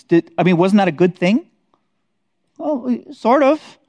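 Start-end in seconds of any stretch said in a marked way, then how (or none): 2.6-3.8 high, slow, drawn-out doubtful hedge; hesitant hedge. Real, not acted. Teacher hedging his own question to the class, slow and doubtful. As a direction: stretch it out, unsure.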